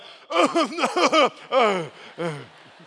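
loud, high laughing outburst; comic laughter. Acted. Preacher imagining himself facing the furnace, loud comic laughing. Mimes reacting in the fiery-furnace joke.

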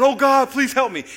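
high, loud desperate plea; cried out to God. Acted. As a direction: beg for rescue at the top of your voice. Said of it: "cried out to God".

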